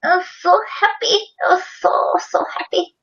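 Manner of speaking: high, giddy and thrilled, a bit breathless; giddy happy voice. Retold or imitated. Telling her cohost how happy she was, high giddy voice. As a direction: gush like a delighted kid. Retold joy in a put-on voice.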